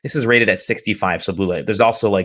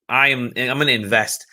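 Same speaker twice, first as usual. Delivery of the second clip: ordinary talk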